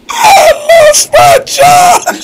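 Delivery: mock crying shout, very high and slow; hammed-up wail. Host mocking his caller's story, wailing very high. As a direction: wail it like a tragic punchline. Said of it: "She say".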